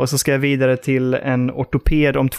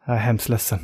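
sorry nurse; 5 semitones lower, 5 dB quieter than usual. Retold or imitated. Nurse breaks bad news.

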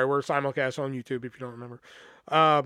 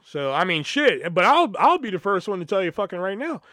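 ordinary talk

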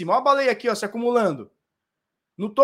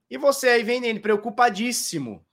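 ordinary talk, nothing marked

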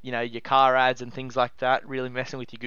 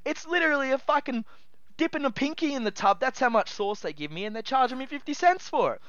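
worked up rant; 7 semitones higher than usual. Real, not acted. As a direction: rage about it at full pitch. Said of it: "I'm getting real worked up over it".

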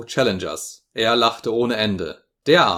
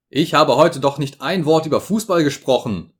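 higher, louder protest; 5 semitones higher, 5 dB louder than usual. A narrator's reading. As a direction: object sharply. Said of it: "protestierte ich".

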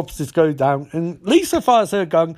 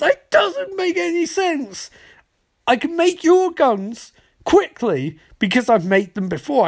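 high-pitched, exasperated protest; exasperated rant; 10 semitones higher than usual. Retold or imitated. Cisco protesting the gold gun, exasperated. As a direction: squeal the objection in mock frustration.

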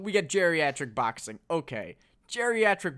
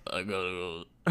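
low mumbled Stallone try; a hint of Stallone; gargling marbles; 9 semitones lower, 8 dB quieter than usual. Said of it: "gargling marbles".

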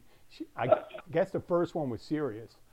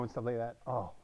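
usual speaking voice

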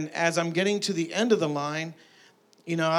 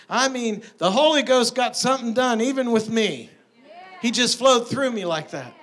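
raised, higher-pitched preacher's proclamation; impassioned preaching; 6 semitones higher, 4 dB louder than usual. Real, not acted. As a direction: preach it with real fervor. Preaches with rising force.

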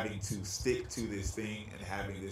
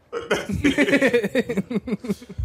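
loud high laugh; high burst of laughter; 11 semitones higher, 9 dB louder than usual. Real, not acted. Laughing at own realization.

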